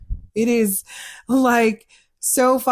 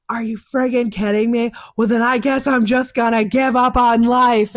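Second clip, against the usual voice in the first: angry client; 5 semitones higher, 6 dB louder than usual. Retold or imitated. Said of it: "get really, really mad at me".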